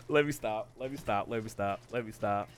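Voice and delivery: soft, fading repetition; sheepish self-check. Real, not acted. Checking himself after laughing fit.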